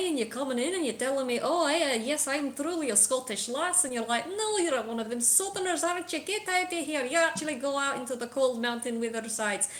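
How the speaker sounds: Scottish accent. Shown as a demonstration. Game master helping a player find the accent, Scottish rant. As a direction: rant like an indignant Scot. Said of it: "I hope that helps".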